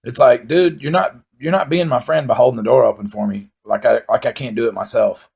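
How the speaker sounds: ordinary talk, nothing marked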